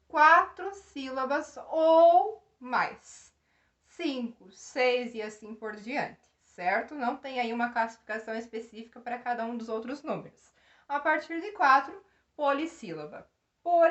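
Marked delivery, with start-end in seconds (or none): none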